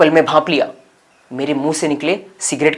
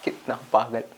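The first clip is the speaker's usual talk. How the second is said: quiet self-reproach; 3 semitones lower, 9 dB quieter than usual. Acted. Regrets his old foolishness.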